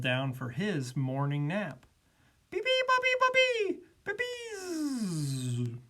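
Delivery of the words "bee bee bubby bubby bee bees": high chirp sliding down and fading; chirp, then drift off on a long buzz; baby bird call winding down into sleep; drowsy bird. A narrator's reading. Bird settles down to nap.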